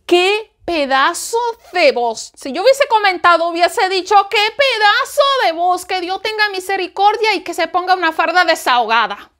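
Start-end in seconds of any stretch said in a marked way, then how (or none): 0.1-2.3 slow, drawn-out exclamation of awe; awed admiration. Pretend. Preacher marvelling at the girl's voice, slow awed exclamation. Voicing admiring comment on singer. 4.3-9.3 high, emphatic burst of praise; exclaimed admiration. Pretend. Preacher voicing the comment he'd have written, high exclaimed praise.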